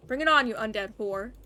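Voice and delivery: loud furious taunt; a soldier screaming a challenge before the fight; angry yell. Acted. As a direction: hurl it at the vampire. Soldier yells challenge at vampire.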